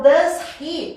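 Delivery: DOES HE slowly as two words, H sounded; DOES HE unlinked. Shown as a demonstration. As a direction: separate DOES from HE with a breathed H. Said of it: "nunca vai ouvir de um nativo".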